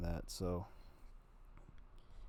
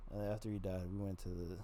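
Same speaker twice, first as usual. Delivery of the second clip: ordinary talk, nothing marked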